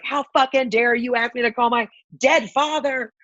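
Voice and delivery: retold indignant tirade climbing to a high shout; taking it out. Grieving daughter taking it out on Costco worker, rising angry rant. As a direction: re-enact an angry blow-up, building in pitch. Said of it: "I just took it out on her".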